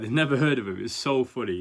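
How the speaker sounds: ordinary talk, nothing marked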